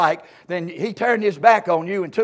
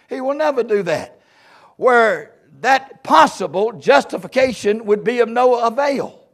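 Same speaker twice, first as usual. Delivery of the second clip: ordinary talk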